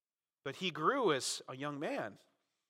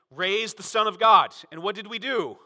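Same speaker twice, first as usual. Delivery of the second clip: louder mock mother; 5 semitones higher, 12 dB louder than usual. Voicing Mary's frantic confession.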